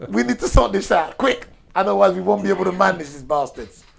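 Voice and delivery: hammed-up fury at the bastards; mock angry rant. King fumes at his scholars, mock angry.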